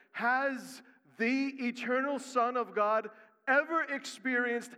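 loud, rising sermon climax; building into a loud preacher's climax; preaching at full force. Acted. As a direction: drive it home to the congregation. Preaching the coming wrath.

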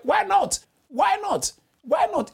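very high, repeated why not; rising challenge. Real, not acted. Preacher urging the congregation to change, pitched high and insistent. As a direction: fling the question up high each time.

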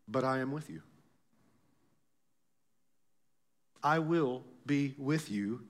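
hushed, low voice of God, slow and deliberate; solemn reassurance. Acted. Voicing the Lord reassuring fearful Gideon.

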